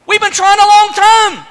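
high, loud complaint; acted indignant objection; exasperated protest. Acted quarrel, Zechariah protesting.

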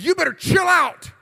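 high, forceful angry warning; re-enacted furious challenge; mad retold shout. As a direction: shout it like you're fed up with a bully. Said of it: "I was just mad".

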